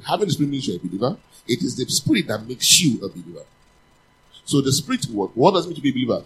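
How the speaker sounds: fast, loud, driving sermon delivery; preaching at full force. Acted. Preacher hammering the point to the church, fast and loud. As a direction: press the point home.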